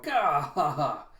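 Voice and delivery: loud bark then a cackle; gruff shout and laugh. Acted. A thug kicking over the soup, shouting and laughing.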